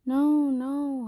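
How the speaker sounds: sudden loud, high snap of disapproval; sharp rebuke. Acted. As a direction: cut her off mid-bow. Queen rejecting a half-hearted bow.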